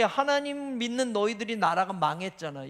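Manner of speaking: ordinary delivery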